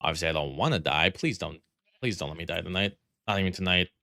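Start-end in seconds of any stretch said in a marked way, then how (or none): none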